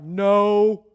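loud, curt, annoyed no; grumpy no. Acted. Tired fishermen answer the stranger on shore, a loud annoyed no. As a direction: bark it like tired men fed up. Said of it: "You know they said it like that".

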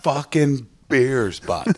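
slow, stretched Southern Ontario jock voice; Southern Ontario jock accent. Shown as a demonstration. Comic imitating Ontario jocks for the host, Southern Ontario jock accent. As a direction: drawl it like a Southern Ontario jock, pitch climbing. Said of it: "the Southern Ontario kinda jockey".

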